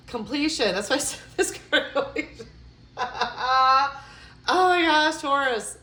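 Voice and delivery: a squeal of vindicated joy; excited exclamation. Real, not acted. Reader to Taurus viewer, overjoyed the card confirms her call.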